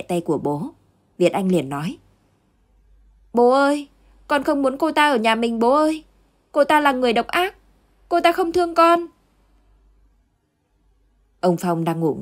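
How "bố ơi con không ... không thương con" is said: put-on high, loud child's pleading cry; a frightened little boy begging his father, voice much higher and louder. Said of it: "bị tiếng hét". (cậu bé kêu khóc van xin bố, giọng cao và to)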